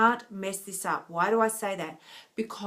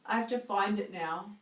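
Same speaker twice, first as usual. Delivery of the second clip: normal talking voice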